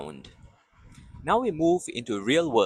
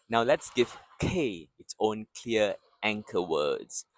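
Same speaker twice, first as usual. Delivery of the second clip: ordinary delivery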